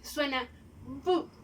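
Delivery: "bu" said slowly and drawn out; letter sound demo. Shown as a demonstration. Teacher showing pupils how B sounds. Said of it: "Y B".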